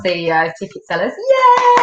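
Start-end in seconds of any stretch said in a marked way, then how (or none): 1.1-1.8 excited cheer. Real, not acted. Cheering for Phil's promotion.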